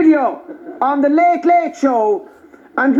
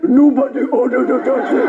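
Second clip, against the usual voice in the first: low imitated Nigerian accent; reply in a put-on Nigerian accent; mock Nigerian accent; 4 semitones lower than usual. Doctor answers the taxi driver, mimicking a low Nigerian accent. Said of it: "winked at me as if to say".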